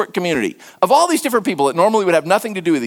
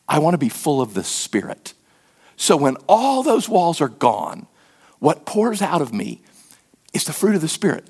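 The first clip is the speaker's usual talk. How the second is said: ordinary talk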